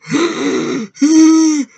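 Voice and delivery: high, loud gasp of a stabbed man; drawn-out high wheeze, imitating the victim; imitated wheezing gasp. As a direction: gasp like a stabbed throat, mock-agonized. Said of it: "but he's like".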